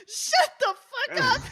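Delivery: drawn-out high scolding; exasperated snap. Acted. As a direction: shut him down, exasperated. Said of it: "Rose just looks at you like".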